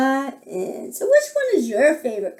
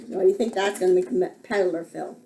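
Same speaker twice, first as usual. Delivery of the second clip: ordinary delivery, nothing marked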